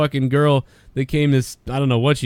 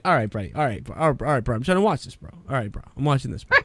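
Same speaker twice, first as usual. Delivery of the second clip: soft, low brush-off; mock annoyed J D; 3 semitones lower, 6 dB quieter, faster than usual. J D brushing off Tommy while watching TV, soft and low. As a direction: mumble him away without looking up. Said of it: "J D was like".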